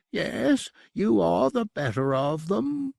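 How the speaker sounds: high, grudging admission in the demon's voice; bitter concession. A narrator's reading. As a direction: concede it through clenched pride, pitched high. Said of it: "it conceded bitterly".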